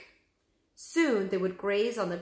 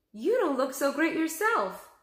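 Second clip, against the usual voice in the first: teasing; 8 semitones higher, 5 dB louder than usual. A narrator's reading. Annie teases the hyenas.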